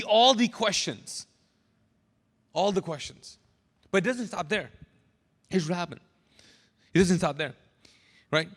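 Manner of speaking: ordinary talk, nothing marked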